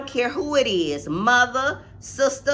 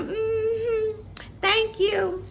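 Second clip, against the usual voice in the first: mock polite thanks; 9 semitones higher, 3 dB quieter, much slower than usual. Retelling her own polite reply.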